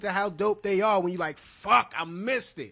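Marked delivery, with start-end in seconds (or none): 1.4-2.7 frustrated outburst at missing out; exasperated outburst. Acted. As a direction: curse out your own bad luck. Acts out regret at missing it.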